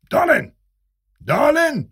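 high-pitched calling out; shout. A narrator's reading. Major yelling after Dalen from the stairs, high shout. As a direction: call the name high across a distance. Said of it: "از بالای راه پله داد زد".

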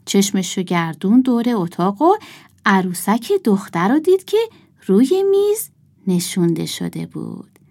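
plain reading voice

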